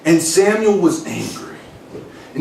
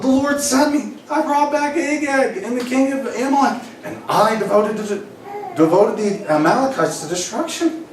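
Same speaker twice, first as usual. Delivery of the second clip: high, laughing whiny excuse voice; excusing Saul; 7 semitones higher than usual. Acted. Preacher voicing Saul defending himself to Samuel, high and laughing. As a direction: play Saul as a giggling kid making excuses. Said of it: "And Saul said to Samuel".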